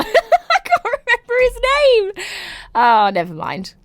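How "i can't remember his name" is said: high-pitched squeal through laughter; helpless, high laughing disbelief. Real, not acted. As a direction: squeak it out while cracking up. Laughing at own forgetfulness.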